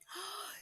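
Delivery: sharp high gasp; a startled intake of breath; alarmed little gasp. Acted. Fish alarmed.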